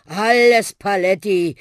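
put-on slow, loud, huffing old bus; wheezing, exhausted. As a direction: heave it out with your last strength. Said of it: "schnaufte der arme alte Bus mit letzter Kraft". (schnaufend, mit letzter Kraft)

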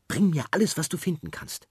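put-on high, bright-eyed little girl voice, insistent; a girl backing up her friend, keen; eager girl. Sophie complains to the seller, high girl's voice. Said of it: "sagt Sophie".

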